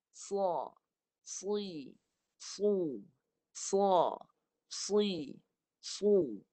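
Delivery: "sa, si, su" dengan bunyi tebal dan padu dari lidah dalam. Shown as a demonstration. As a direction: sebut "sa" tebal, padu, bibir dilebarkan. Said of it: "Bunyi daripada dalam". (sebutan "sa, si, su" yang tebal dan padu)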